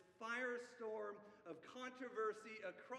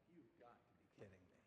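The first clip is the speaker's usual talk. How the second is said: incredulous aside; 13 semitones lower, 20 dB quieter, faster than usual. Retold or imitated. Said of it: "Nicodemus is just like".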